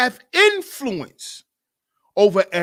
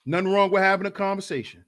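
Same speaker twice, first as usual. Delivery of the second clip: usual speaking voice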